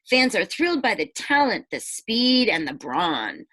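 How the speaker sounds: rhyming storybook read-aloud; picture-book narration; reading to children. A narrator's reading. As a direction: lilt the rhyme for the kids. Reads rhyming book to class.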